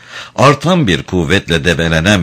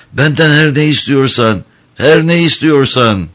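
higher, choked pleading; 8 semitones higher than usual. A narrator's reading. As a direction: beg in a stifled voice, pitched up. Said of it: "boğuk bir ses tonuyla". (boğuk, yalvaran bir sesle)

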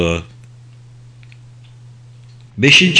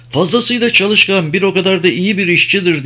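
higher, nervous country farmer voice; anxious farmer; 8 semitones higher, 3 dB louder than usual. Acted. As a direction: voice a worried old farmer pleading. (endişeli, tiz sesli çiftçi)

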